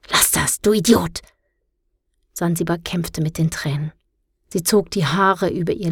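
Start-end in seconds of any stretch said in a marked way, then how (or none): 0.1-1.2 hissed, rising on Idiot; angry hiss. A narrator's reading. Girl snapping at the boy teasing her, angry hiss. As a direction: snap it at him furiously. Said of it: "Wütend ... fauchte".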